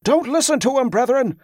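high, forceful bark. A narrator's reading. Lieutenant priest warning the pilgrims, high bark. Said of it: "the lieutenant barked".

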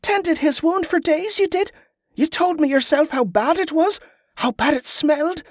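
put-on high-pitched girl, taken aback; high girlish protest; protesting young Molly. A narrator's reading. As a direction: argue back in a high child's voice.

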